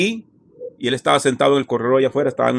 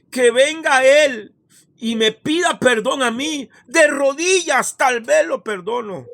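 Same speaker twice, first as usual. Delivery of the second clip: high, slow indignant challenge to God; high, drawn-out resentful demand for an apology; bitter, resentful defiance; 11 semitones higher than usual. Retold or imitated. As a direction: spit it out with wounded pride, slowly. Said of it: "había odio y rencor en mi corazón".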